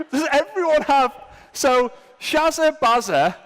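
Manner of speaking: high, loud words through laughter; real laughter. Real, not acted. Speaker amused by Australian nicknames on the whiteboard, laughing. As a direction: try to talk while cracking up.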